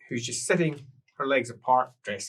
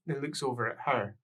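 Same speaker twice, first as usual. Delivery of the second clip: usual speaking voice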